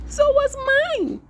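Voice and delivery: very high, loud and alarmed; upset letter F. Acted. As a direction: cry it out in dismay.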